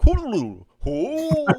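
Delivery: high trilled gibberish then long hoot; Pianta call. Acted. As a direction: mimic the creature's whooping cry. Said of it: "they go like".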